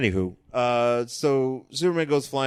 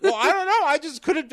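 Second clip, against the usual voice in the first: squeaky, higher, laughing it off; high laughing protest. Real, not acted. Laughing protest to his friend about the stair fall. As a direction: defend yourself through giggles. Recalling falling on stage.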